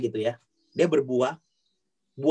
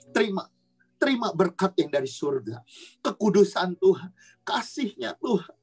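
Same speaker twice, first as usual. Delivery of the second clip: rising prayer blessing, higher and building; fervent prayer; 5 semitones higher than usual. Acted. Sister prays blessing over the children's heads, rising fervent prayer. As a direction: call down the blessing on them.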